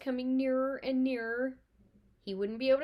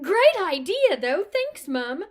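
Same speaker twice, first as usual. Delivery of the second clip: loud, high gleeful cheek; cheeky glee; 9 semitones higher, 14 dB louder, slower than usual. Acted. Twins joking to their mother, loud cheeky glee. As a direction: tease her with mock gratitude.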